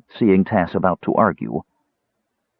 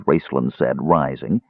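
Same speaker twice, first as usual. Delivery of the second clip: ordinary delivery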